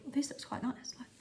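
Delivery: breathy whisper to the camera. Pretend. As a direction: whisper it secretly. Whispered aside, unvoiced, -12 dB.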